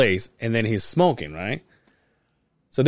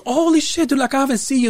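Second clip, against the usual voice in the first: high, thrilled surprise; imitating a happy shout at seeing an old friend; excited reunion; 12 semitones higher, faster than usual. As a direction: greet an old friend you've missed, pitched up.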